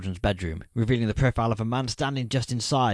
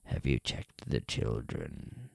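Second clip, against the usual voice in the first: much lower, very slow, drawn-out creepy voice; creepy caller; 10 semitones lower, 7 dB quieter, much slower than usual. Acted. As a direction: drag each word out low like a stalker savouring it. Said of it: "says the creepy voice".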